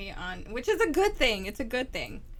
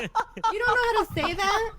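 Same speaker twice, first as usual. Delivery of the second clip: mock shock; 11 semitones higher, 6 dB louder than usual.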